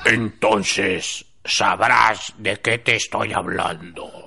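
put-on slow, low old man voice; creaky slow old-timer fading out. Shown as a demonstration. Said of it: "Pinche voz de viejito".

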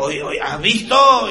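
high, slow Spaniard whisper-shout in awe; Spanish accent. Retold or imitated. Spaniard nudging his friend at the urinal, Spanish accent. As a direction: gawk like a Spaniard. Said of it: "le dice al otro".

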